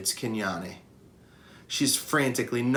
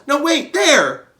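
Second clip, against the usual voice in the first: high, loud and slow correcting yell; excited yelling; 10 dB louder, slower than usual. Acted. Young Ruby yelling a correction to the herd. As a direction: point and shout again. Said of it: "Ruby yells".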